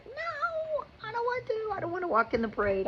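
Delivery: put-on high, fast whining child; mimicking her son's high protest; whiny kid voice. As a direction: plead like a stubborn little boy. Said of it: "And Giovanni's like".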